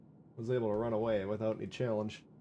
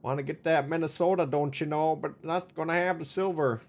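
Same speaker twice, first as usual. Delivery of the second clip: put-on upbeat Minnesota accent, a bit higher; 5 semitones higher, 3 dB louder than usual. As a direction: play it up like a Minnesotan. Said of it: "your Minnesota accent sounds vaguely familiar".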